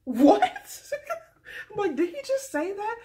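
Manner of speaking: high, loud disbelief; shocked. Real, not acted. Reacting to Ari's cold line, shocked disbelief. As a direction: gasp it in disbelief.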